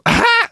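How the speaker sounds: high, loud cry of amusement; imitated startled shout; retold outburst. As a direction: burst out with a high "Ah!". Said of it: "looks up and just goes".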